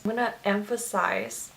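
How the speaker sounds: ordinary delivery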